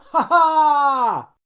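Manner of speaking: loud high gotcha laugh-shout; spring the trap with a yelled ha ha; boy ambushing Santa, shouting in triumph; triumphant shout. Acted. Bursting out from under table.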